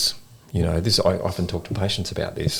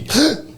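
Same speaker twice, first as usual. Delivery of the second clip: sudden high gasp for breath; imitated deep gasp. Old lady suddenly comes back to life, imitated gasp. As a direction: mimic a sharp, big intake of air. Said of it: "takes a, a big deep breath".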